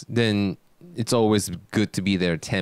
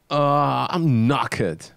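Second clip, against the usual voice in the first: British "knackered", pitched up with a drawn-out groan first; British accent impression; 5 semitones higher, slower than usual. Shown as a demonstration. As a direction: try your friend's British accent. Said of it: "Why don't you try it?".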